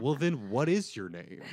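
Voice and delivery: a baffled doctor voiced through laughter; laughing through the quote. Telling friends the doctor story, laughing through the quote.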